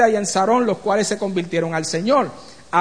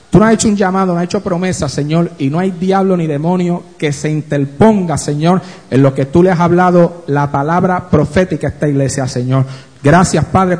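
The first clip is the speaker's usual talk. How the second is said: lower, louder, intoning prayer voice; fervent prayer; 6 semitones lower, 3 dB louder than usual. Acted. Preacher praying aloud for the church, fervent and low. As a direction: pray over the congregation with fervour.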